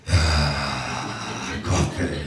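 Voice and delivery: very low, rasping drugged-up groan; wired caffeine growl. Retold or imitated. Comedian staring wide-eyed from coffee, groaning very low. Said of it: "There's me going".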